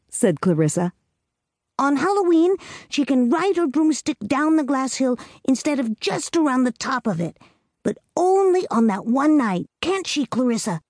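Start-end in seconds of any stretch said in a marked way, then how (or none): 1.8-10.8 put-on higher, earnest little girl voice; a small girl laying down the rules; little girl Amy. A narrator's reading. Amy telling Clarissa the witch's fate, in a higher girlish voice. Amy proposes Halloween rule.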